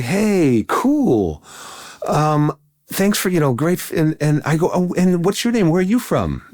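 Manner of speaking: high, chatty imitation of friendly greeting; breezy high-voiced meet-and-greet; retold cheery small talk. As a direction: play your oblivious past self, pitched high. Retold friendly chat with unknown stranger.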